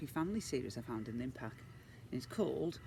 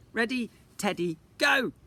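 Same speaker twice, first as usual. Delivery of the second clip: starter's shout; 8 semitones higher, 9 dB louder than usual. Acted. Teddy race starter rising to a loud high go.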